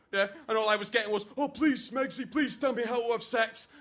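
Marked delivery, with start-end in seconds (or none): 1.4-3.7 higher, urgent imitation of begging; mock pleading. Best man imitating his friend begging for advice, mock pleading. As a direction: beg like a desperate mate, hammed up.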